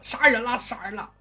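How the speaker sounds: high alarmed cry of murder; shouting for help. Acted. Liu Yong, attacked in the street, yelling for help. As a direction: yell it out to the street. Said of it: "当时就喊".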